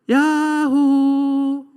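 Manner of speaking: long, high, loud held call; drawn-out "yahoo" shout to the hills; mountaintop yell. Acted. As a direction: holler it out from a mountaintop. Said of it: "이렇게 하잖아요".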